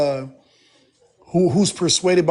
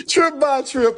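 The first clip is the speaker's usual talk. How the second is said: high calling goodbye; 7 semitones higher, slower than usual. Real, not acted. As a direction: call his name up high.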